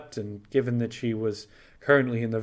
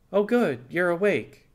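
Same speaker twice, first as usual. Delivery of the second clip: higher, louder cheerful greeting; smiling, bright; 7 semitones higher, 4 dB louder than usual. Acted. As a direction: beam as you say it. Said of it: "he smiled".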